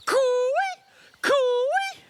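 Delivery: high long cooey call; calling in a forest. Real, not acted. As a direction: call out across the bush. Calling in the bush.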